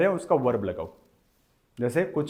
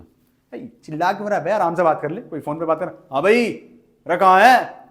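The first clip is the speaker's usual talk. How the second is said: imitating someone yelling into a phone, higher and much louder at the end; hammed-up loud caller; loud phone talker; 5 semitones higher, 9 dB louder than usual. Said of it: "कुछ लोग बात करते हैं ना इस तरीके से". (Phone पर ज़ोर से चिल्लाकर बात करने वाले की नकल)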